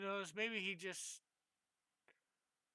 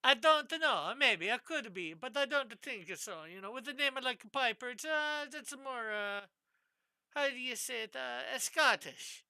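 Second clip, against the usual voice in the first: higher, sing-song mock Italian accent; put-on Italian accent; 5 semitones higher, 5 dB louder than usual. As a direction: play up a bouncy Italian accent. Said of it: "maybe you're secretly Italian".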